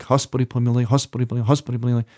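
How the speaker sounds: rapid Slavonic prayer, words fused; very rapid repetition. Shown as a demonstration. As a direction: rattle it off almost as one word. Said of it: "done in a way that's very rapid".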